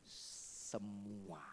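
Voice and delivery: deep, hushed divine voice; solemn low voice of God. Acted. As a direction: let God answer low and soft. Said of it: "Jawabnya gini".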